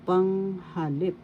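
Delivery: Panghalip slowly, high held PANG, then ha-LIP rising; syllable-by-syllable demo. Shown as a demonstration. Teacher breaks the word down for learners, Panghalip slow and rising at LIP. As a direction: draw out Panghalip, lifting the end on LIP. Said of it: "third syllable lip".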